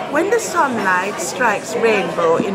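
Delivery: slow, careful put-on RP; deliberately posh RP recitation; speaky spoken. Shown as a demonstration. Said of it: "So if I was being speaky spoken".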